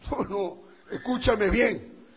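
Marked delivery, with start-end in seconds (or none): none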